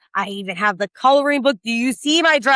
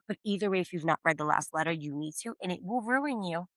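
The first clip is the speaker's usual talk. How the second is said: usual speaking voice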